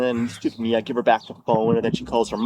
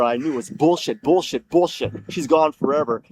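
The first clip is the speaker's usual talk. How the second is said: ordinary delivery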